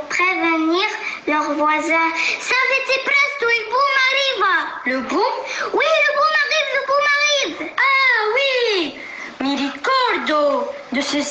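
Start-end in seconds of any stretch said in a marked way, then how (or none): 2.4-4.6 shouting. Acted. Animals shout warning. 5.7-8.9 shouting. Acted. Animals shout warning.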